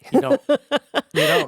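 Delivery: very high, loud squeal; loud falsetto punchline; comic exclamation. Real, not acted. Joking answer to rhetorical question.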